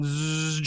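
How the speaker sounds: sustained buzzing "zzz"; imitated clipper buzz. Customer shows how the barber went, imitated buzz. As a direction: mimic clippers running across a head. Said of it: "she went like that".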